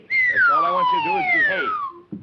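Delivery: shrill squeal; squeaky high voice. Acted. Host scolds clown, very high.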